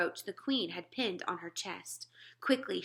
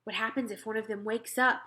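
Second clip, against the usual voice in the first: plain reading voice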